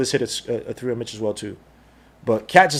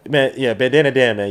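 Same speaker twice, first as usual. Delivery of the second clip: ordinary talk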